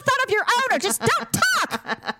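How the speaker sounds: high-pitched acted scolding; mock furious rant. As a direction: lay into a partner in a hammed-up tirade. Acting out a marital row.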